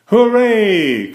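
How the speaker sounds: loud, high joyful cheer. A narrator's reading. Boys cheering that the baby is coming, loud excited cheer. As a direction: shout it out in triumph. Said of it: "cried Franklin and Bear".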